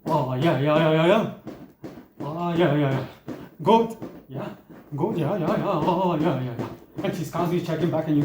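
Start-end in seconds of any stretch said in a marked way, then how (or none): 0.1-6.7 mock factory-worker encouragement, lightly voiced; dutiful partner. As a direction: check in like a supervisor on duty. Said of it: "So it'll go something like this".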